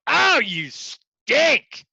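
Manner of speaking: high, loud, slow mocking jeer; slow, high, loud scornful jeer; sneering put-down. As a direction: drag it out like a mean instructor sneering. Mock put-down to a trainee.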